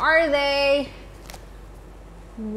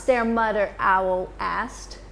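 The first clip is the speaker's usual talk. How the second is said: ordinary delivery, nothing marked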